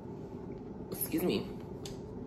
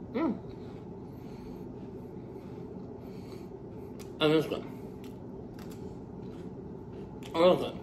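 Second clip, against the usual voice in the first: chewing while speaking; talking through a mouthful; mouth full; 11 dB quieter, much faster than usual. Real, not acted. Seen 0: bites wafer roll at 0.1, chews closed-mouthed through 4.4.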